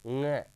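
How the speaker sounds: ng as one nasal nga, no separate n; silent-n pronunciation. Shown as a demonstration. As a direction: let the n melt into ng, held through the nose. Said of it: "न silent mode पे काम करेगा". (ng में न silent, नाक से "ङ")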